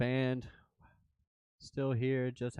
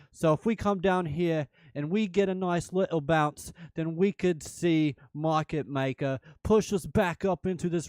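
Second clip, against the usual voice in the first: loud, higher-pitched mock British accent drifting Australian; rally the viewers in a hammed-up British accent; put-on British accent; 5 semitones higher, 6 dB louder than usual. Shown as a demonstration. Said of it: "my British accent sounds Australian".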